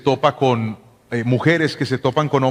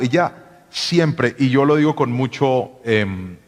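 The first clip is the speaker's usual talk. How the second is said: ordinary talk, nothing marked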